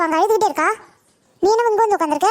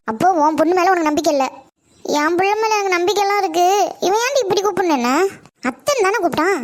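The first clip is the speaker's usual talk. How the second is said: ordinary talk, nothing marked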